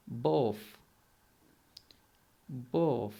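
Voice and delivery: بوف with th said as f; British accent both. Shown as a demonstration. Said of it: "تو اسے ہم کس طرح پڑھیں گے".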